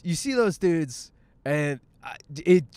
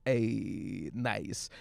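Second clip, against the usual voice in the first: lower, softer leering hey nice; creepy gawker; 6 semitones lower, 7 dB quieter than usual. Retold or imitated. Mocking gym gawkers, a low leering murmur. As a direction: lean in like a creep. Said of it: "They're like".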